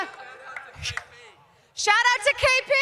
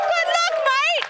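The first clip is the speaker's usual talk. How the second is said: imitating Australian accent; 7 semitones higher, slower than usual. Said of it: "I hear an Aussie accent".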